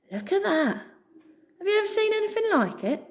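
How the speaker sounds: high, fast sneering rat voice. Acted. Rat nudging sparrow, high and sneering. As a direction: snigger at the strange sight. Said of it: "the rat said under his breath".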